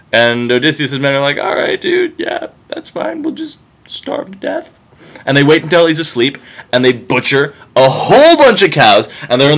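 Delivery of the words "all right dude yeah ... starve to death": high, quiet mocking sarcasm; sarcastic whiny crew. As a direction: grumble sarcastically like sulking sailors. Sulky crew answering Odysseus.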